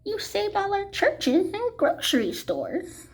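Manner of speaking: high, small mayor voice; the tiny mayor calling up to Horton; tiny faraway voice. A narrator's reading. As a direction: speak up from a speck of dust. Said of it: "came the voice".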